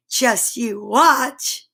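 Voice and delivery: slow and sly. Acted. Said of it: "said the cats".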